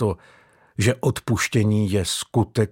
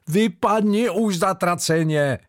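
angry; 9 semitones higher than usual. A narrator's reading. Mario throws a boot.

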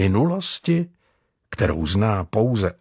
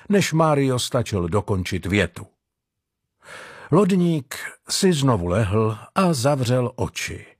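ordinary delivery

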